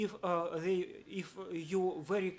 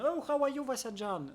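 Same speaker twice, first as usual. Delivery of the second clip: friendly greeting; 8 semitones higher, 5 dB louder than usual. Retold or imitated. Coworkers' greeting retold.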